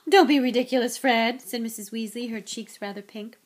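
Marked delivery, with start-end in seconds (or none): 0.1-1.4 loud, indignant dismissal; flustered rebuke. Acted. Mother denying she fancies the author, indignant. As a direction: brush off the teasing loudly.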